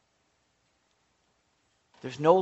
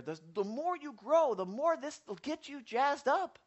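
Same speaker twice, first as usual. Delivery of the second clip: usual speaking voice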